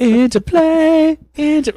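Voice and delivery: very high, slow, silly squeak; squeaky high. Pretend. Repeats word very high.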